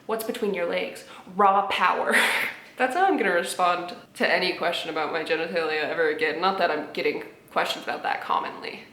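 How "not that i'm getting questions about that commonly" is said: ordinary delivery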